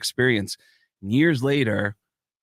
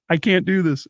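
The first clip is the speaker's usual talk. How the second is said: higher, mock-helpless jeer; mocking heckler; 6 semitones higher, 4 dB louder than usual. Friend at a wedding mocking his stage failure, high jeer. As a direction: imitate someone's failed moment to tease. Mimics heckler at back of room.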